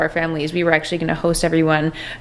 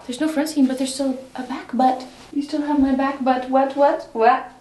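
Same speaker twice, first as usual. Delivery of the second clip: higher, giddy amazement; delighted disbelief; 6 semitones higher than usual. Real, not acted. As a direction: squeal over the fit.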